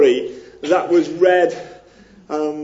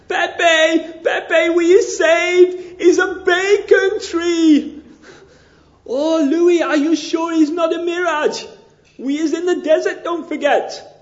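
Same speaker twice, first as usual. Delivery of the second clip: put-on much higher, slow, loud excited joke accent drifting to Liverpool; overjoyed wanderer who spots the bacon tree, in a Mexican accent turning Scouse; comic Mexican accent slipping Scouse; 4 dB louder than usual. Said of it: "Is it going Scouse again?".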